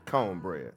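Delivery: "cone bread" with a long, rounded "cone"; Southern "cone bread". Shown as a demonstration. Preacher teaching great-grandmother's word for cornbread, "cone bread" with no R. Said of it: "That's how you gotta say it".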